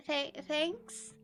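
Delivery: ordinary talk, nothing marked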